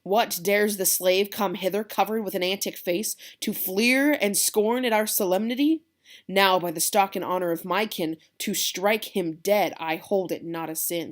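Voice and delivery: loud, slow, seething threat; angry hothead cousin ranting; Tybalt's fury. Acted. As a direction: vow to strike him dead. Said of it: "Tybalt says".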